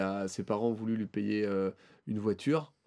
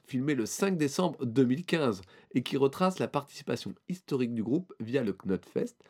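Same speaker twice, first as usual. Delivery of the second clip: usual speaking voice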